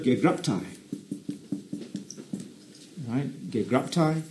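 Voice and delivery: "gegraptai" said as ge-grap-tai, gently, with a hard g; Greek word. Shown as a demonstration. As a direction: spell the Greek out, "gegraptai" syllable by syllable. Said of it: "comes from one word in Greek".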